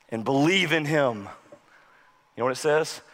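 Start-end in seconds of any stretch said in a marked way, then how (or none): none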